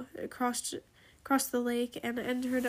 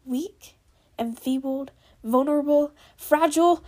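shouting; 3 semitones higher, 9 dB louder than usual. A narrator's reading. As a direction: voice building to a loud, higher shout. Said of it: "she shouted".

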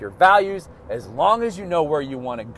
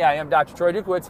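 usual speaking voice

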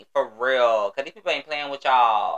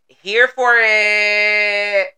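drawn-out excited; 8 semitones higher, 7 dB louder, much slower than usual. Acted. Slow drawn-out catchphrase.

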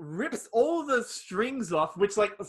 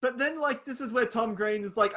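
ordinary talk, nothing marked

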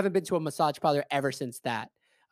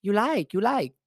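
higher, repeated coaxing in mock Chinese accent; imitating young Chinese masseuse; 5 semitones higher than usual. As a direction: coax with a put-on Chinese accent. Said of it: "she goes".

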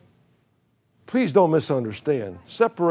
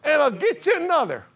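much higher, near-giggling mountain man punchline; laughing mountain man. Retold or imitated. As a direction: crack up while delivering the punchline. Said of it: "He said".

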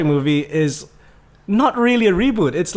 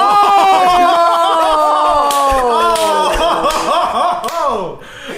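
loud laugh; 8 semitones higher, 4 dB louder than usual. Real, not acted. Friend roasted, bursts out laughing.

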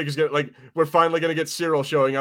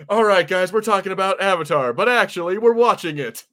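higher, louder mock host voice; mock announcer; 6 semitones higher, 5 dB louder than usual. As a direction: play the cheeky host tricking the audience. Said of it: "it's like".

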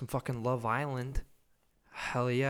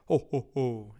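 lightly voiced jolly Christmas laugh, louder; Santa Claus; 7 dB louder than usual. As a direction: give a brief, louder mock ho-ho-ho. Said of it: "the Santa Claus just flies over".

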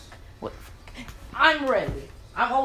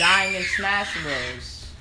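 drawn out; much slower than usual. Acted. Drawn out intro line.

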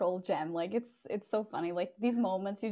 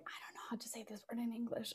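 whisper; 17 dB quieter, faster than usual. Retold or imitated. Retelling own quiet remark at work.

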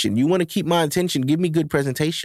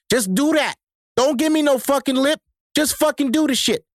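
exasperated rant, pitched higher; heated rant; 7 semitones higher than usual. Real, not acted. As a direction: demand it with rising irritation. Heated demand at women.

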